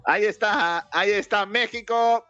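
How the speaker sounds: high-pitched, drawn-out football announcer delivery; play-by-play commentary. Real, not acted. Commentator readies listeners for Mexico's match, high play-by-play. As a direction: call the kick-off like a match commentator.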